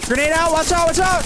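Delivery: high, loud urgent warning; combat callout. Real, not acted. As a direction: shout the grenade warning. Grenade warning to squad.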